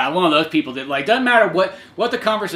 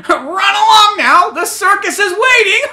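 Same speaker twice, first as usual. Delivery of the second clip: high, loud, slow mocking dismissal; taunting rooster; 9 dB louder, slower than usual. The rooster sending the group off, high and mocking. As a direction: shoo them off with a sneer. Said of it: "the rooster just kinda looks".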